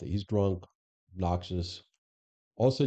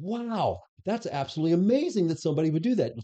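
sarcastic disbelief; 8 semitones higher than usual. Retold or imitated. Reacting to police beating video.

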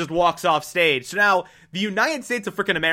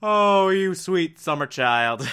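laughing pity; 2 semitones higher, much slower than usual. Real, not acted. Pitying laugh at naivety.

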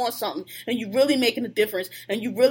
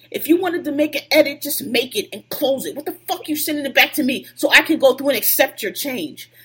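ordinary talk, nothing marked

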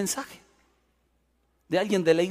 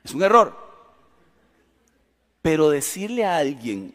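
ordinary talk, nothing marked

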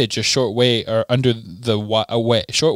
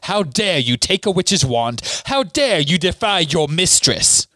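furious witch; 6 semitones higher than usual. Acted. Bellatrix rages at Dobby.

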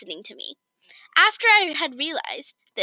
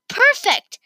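higher, louder burst of delight; excited exclaim; 10 semitones higher, 4 dB louder than usual. Acted. Emma loving mom's comedy show idea, excited exclaim. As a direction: jump at the idea. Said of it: "I exclaimed".

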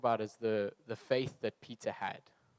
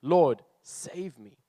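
slow, louder desperate plea; crying out; 3 semitones higher, 8 dB louder, slower than usual. Acted. Sinking Peter crying to Jesus, slow and louder. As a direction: cry for rescue while sinking. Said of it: "cried out".

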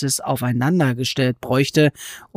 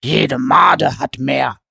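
slow, loud contemptuous jab; scornful insult; 5 dB louder, much slower than usual. A narrator's reading. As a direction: drag out the insult. Pen's insult.

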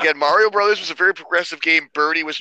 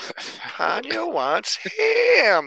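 put-on slow, high childlike Ponyo voice; 14 semitones higher, much slower than usual. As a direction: stretch each word out like a toddler. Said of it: "reached Ponyo status where he likes ham".

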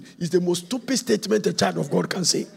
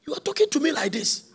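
angry angel; 5 semitones higher, 6 dB louder than usual. Acted. Gabriel snapping at Zachariah, high loud stern. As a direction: cut him off and assert rank. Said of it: "introduced himself and said".

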